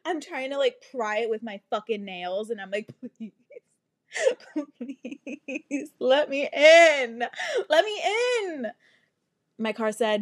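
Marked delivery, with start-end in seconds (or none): 3.0-8.7 hammed-up wailing plea; mock-crying, rising to a loud wail; desperate pleading. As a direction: beg the locked car, high and drawn out. Pleading with locked car.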